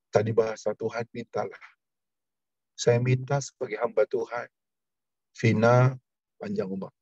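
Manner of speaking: prayerful, pleading intercession voice; earnest prayer. Acted. Pastor praying for sick Vina before the church, earnest prayer. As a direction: pray over them with steady conviction. Pastor prays for congregation members.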